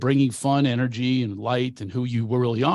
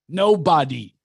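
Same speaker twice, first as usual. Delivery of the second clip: normal talking voice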